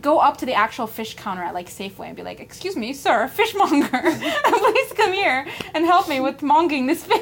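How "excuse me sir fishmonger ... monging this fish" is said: mock polite customer. Mock customer calling the fishmonger.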